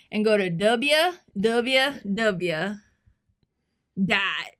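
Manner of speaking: put-on grandpa dictating slowly in a Southern accent. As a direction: imitate grandpa's Arkansas accent, spelling it out loudly. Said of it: "he's from Arkansas, so he has an accent".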